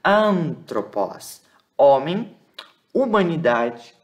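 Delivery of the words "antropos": "antropos" slowly, long nasal AN and open final O; slow pronunciation demo. Shown as a demonstration. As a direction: stretch antropos: nasal AN, then tro, open POS. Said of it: "Aqui é um som mais aberto".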